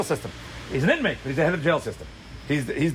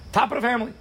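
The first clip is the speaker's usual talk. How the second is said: higher emphatic repeat; 7 semitones higher, faster than usual. Real, not acted. Preacher repeats top of the family, pitched up high.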